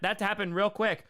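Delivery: normal talking voice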